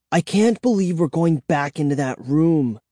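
ordinary delivery, nothing marked

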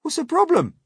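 high, loud, fast; cheeky bug; centipede voice. Acted. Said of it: "the centipede asked".

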